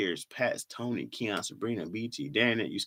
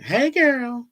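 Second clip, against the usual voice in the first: put-on girly voice; 13 semitones higher, 7 dB louder than usual. Reading viewer chat in a voice.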